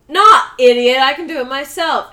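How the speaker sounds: put-on loud, cocky kid brush-off; bratty loud retort. Michael brushing off Tommy's offer, loud and cocky. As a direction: blurt it out like a spoiled brat.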